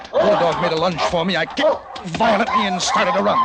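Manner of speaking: breathless panic mounting line by line; panicked escape. Acted. Narrator fleeing a pack of dogs.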